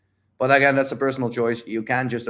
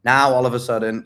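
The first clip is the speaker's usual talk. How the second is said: normal talking voice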